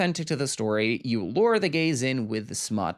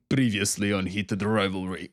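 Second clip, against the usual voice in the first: a light mock thick Russian accent, lower; imitating Russian accent; 4 semitones lower than usual. Vlogger quoting Ilya's recap in a lightly done Russian accent. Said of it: "with that very thick Russian accent".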